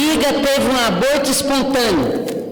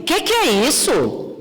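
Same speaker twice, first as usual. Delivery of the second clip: higher, brushing-off doctor; dismissive doctor; 8 semitones higher than usual. Retold or imitated. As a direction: wave away her grief, impatient. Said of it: "o médico virou pra ela e falou assim". (médico indiferente, mais agudo e impaciente)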